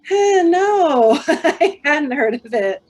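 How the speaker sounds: high, loud, laughing "no" of dismay; hammed-up horrified reaction; mock dismay. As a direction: playfully wail it with a laugh. Retold dismayed reaction to hearing news.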